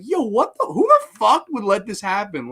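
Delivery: high, loud incredulous outburst; mock outrage; disbelief. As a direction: yell it in disbelief. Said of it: "I'm like".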